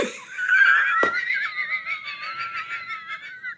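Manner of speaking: helpless high-pitched wheezing laugh; long high laugh. Real, not acted. Recalling the missing flyers, a long helpless laugh. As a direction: crack up and let it run out long.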